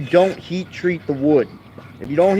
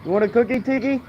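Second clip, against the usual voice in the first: high pet voice; 8 semitones higher, faster than usual. Real, not acted. Offering dog a cookie.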